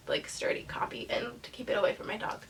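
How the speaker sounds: whispered aside; whispering. Real, not acted. Aside about keeping book from dog.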